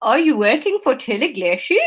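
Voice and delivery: tentative attempt at a Dutch accent; bad Dutch accent. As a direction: Dutch accent, about to crack up. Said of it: "integral to my Dutch accent".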